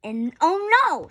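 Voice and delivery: loud, high gasp of alarm; dramatic alarm. A narrator's reading. Child reading the scary moment, loud alarm. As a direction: make it a big storybook shock.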